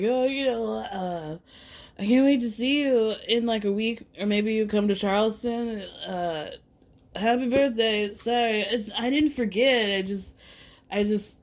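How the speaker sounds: mock tipsy stammer, trailing into hesitant fragments; drunk, sheepish son. Drunk son calling mom after forgetting her birthday, mock sheepish. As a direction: lightly imitate a drunk, fumbling apology. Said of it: "So he calls her drunk, sloppy".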